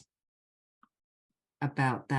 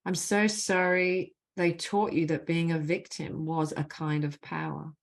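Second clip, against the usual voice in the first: measured, deliberate leading of a phrase; guided healing phrases; 2 semitones higher than usual. Acted. Facilitator gives the group apology phrases to repeat, measured and deliberate. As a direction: lead the group line by line, leaving space after each.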